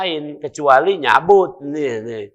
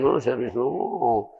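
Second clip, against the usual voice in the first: mock serious; 3 semitones lower, 5 dB quieter, slower than usual. Mocking a stern serious face.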